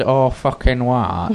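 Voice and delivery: slow lightly voiced bro imitation; a hint of a dumb Chad, drawled. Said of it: "in Chad voices".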